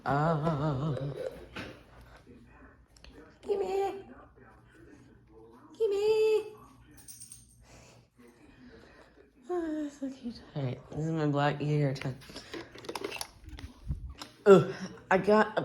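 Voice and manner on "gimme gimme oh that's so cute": put-on high baby-voice coo; cooing pet talk. Talking sweetly to his pet, high cooing voice.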